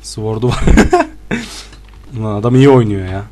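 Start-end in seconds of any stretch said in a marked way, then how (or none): 0.7-1.6 a sudden high-pitched squeal of laughter. Real, not acted. Laughing at the strong opponent, high squeal.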